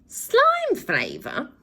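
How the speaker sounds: high, very slow appalled question; bawled. Acted. Villain appalled by the offer, high and slow. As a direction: bawl it in disgust. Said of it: "bawled Glenn".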